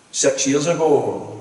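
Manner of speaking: ordinary talk, nothing marked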